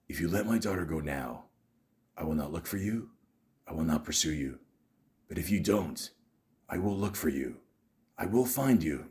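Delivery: low, soft, menacing phone threat; quiet, deadly Bryan Mills speech; Liam Neeson Taken impression. Shown as a demonstration. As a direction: threaten the kidnapper in a low steady voice. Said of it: "practice this a few times".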